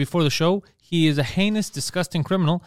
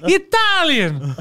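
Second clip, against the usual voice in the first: mock Italian; 11 semitones higher, much slower than usual. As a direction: play a cartoon Italian man protesting. Mock Italian accent bit.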